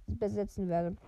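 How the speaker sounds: plain reading voice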